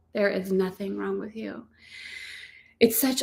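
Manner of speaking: usual speaking voice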